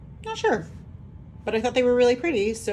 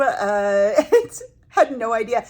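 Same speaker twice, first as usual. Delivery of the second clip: laughing through words; 3 semitones higher, 7 dB louder, slower than usual. Real, not acted. Laughing at her own blunder.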